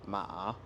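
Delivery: MA dipping, then rising; drawn ma with a dip; third tone. Shown as a demonstration. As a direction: let ma sink and climb back up. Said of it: "qui descend puis qui remonte".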